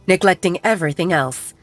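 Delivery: usual speaking voice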